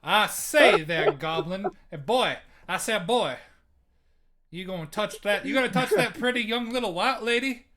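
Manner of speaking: southern plantation owner. Pretend. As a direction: ham up an old Southern gentleman scolding a boy. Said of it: "talks like a southern plantation owner".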